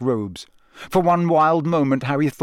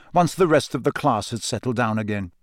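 ordinary delivery, nothing marked

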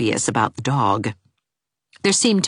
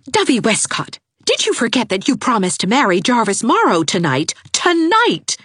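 high, indignant reproach; indignant young woman; scolding Anne; 9 semitones higher, 4 dB louder than usual. A narrator's reading. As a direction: confront her friend.

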